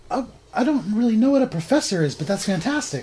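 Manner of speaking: high, cheery goblin voice; a lightly voiced, delighted goblin. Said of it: "switches over immediately to Goblin".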